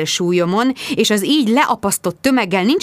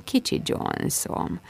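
slow, soft whisper; 10 dB quieter, slower than usual. A narrator's reading. Daniel whispers to Bridget in his arms, slow and soft. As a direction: breathe it close to her ear. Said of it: "súgta gyöngéden".

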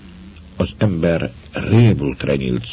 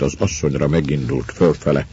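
ordinary delivery

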